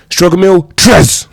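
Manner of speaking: loud, high announcer call; hype announcement. Real, not acted. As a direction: shout the title like a game show host. Announcing the next meal.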